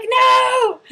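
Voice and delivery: high, loud wail of protest; hammed-up horrified yell; mock panic. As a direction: act out a mortified shout. Retold protest at staring men.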